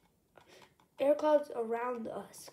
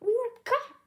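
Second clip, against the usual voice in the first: excited shout; 11 semitones higher, 8 dB louder than usual. A narrator's reading. Character exclaims in story.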